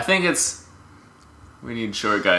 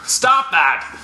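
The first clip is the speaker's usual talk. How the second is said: high scolding voice; 8 semitones higher, slower than usual. Acted. Scolding in a comic bit.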